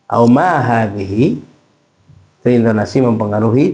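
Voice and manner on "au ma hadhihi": slow question with a rising end; "Au ma hadhihi" drawn out and rising; question intonation. Shown as a demonstration. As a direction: ask "Au ma hadhihi", lifting the end. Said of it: "Beda dengan pertanyaan".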